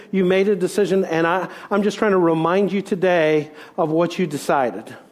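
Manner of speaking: usual speaking voice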